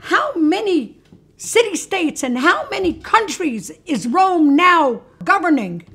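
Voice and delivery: high, loud declamation; hammed-up imperial pomp; grand Caesar. Caesar quizzes the steward on his empire, grandly.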